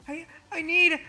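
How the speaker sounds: high, weak faked swoon; faking fainting. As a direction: play it like you're about to pass out. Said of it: "I pretend to start passing out".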